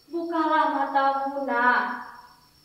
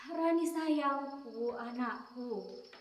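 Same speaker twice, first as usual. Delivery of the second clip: tender plea; 3 semitones lower, 11 dB quieter, much slower than usual. Acted. Mother softens during quarrel.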